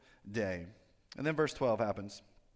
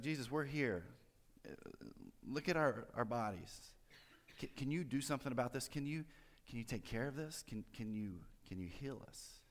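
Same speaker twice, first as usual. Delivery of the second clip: hesitant plea; 7 dB quieter than usual. Acted. Lepers asking Jesus to heal them, hesitant soft plea. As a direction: ask haltingly, quieter with each try.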